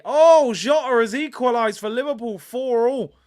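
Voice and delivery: loud, high breaking-news call; excited announcement. Real, not acted. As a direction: blurt out the live score change. Live goal news on stream.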